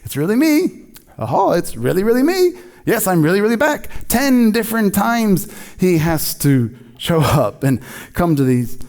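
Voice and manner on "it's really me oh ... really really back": high, eager "it's really me" as Jesus; Jesus reassuring. Retold or imitated. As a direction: pitch it up, insisting he's back. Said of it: "he shows up and, like".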